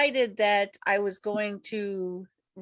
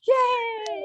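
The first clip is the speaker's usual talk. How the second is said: excited cheer; 14 semitones higher, 6 dB louder than usual. Real, not acted. Cheering Erica's return.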